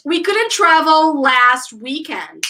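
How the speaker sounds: slow, evenly spaced model sentence; teacher's drill delivery, unhurried; repeat-after-me model. Shown as a demonstration. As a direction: say it slowly for learners to repeat. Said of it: "So, repeat".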